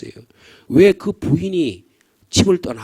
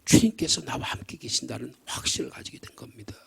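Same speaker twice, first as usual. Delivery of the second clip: whisper; 5 semitones lower, 9 dB quieter than usual. Acted. Preacher hushes for emphasis.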